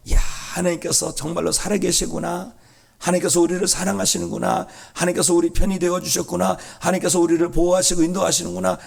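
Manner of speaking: high, exclaiming wonder; awed realisation. Acted. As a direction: marvel aloud at God's care. Voices the people's awed realisation.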